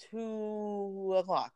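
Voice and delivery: drawn out, dramatic slow delivery. A narrator's reading. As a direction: stretch it for suspense. Dramatic story pause.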